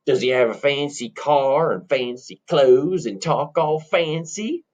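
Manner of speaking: slow, drawn-out rustic question; countrified probing; suspicious country bumpkin. Acted. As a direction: drawl the question, rising at the end. Plays countrified twin brother.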